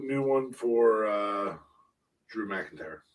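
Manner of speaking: usual speaking voice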